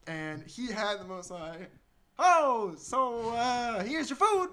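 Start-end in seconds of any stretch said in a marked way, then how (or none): 2.2-4.5 high, loud waiter with Texan twang; slight Texan accent. Retold or imitated. Waiter bringing the food, slight Texan accent. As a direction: imitate a waiter, faintly Texan. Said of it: "I almost had a slight Texan accent".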